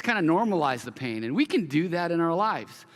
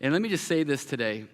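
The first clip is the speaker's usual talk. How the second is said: ordinary delivery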